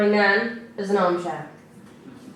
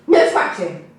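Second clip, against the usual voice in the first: put-on louder, sharp no to a child; sharp telling-off; 7 dB louder than usual. A narrator's reading. Jim scolding his scratching daughter, sharp and loud. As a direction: snap it at the kid.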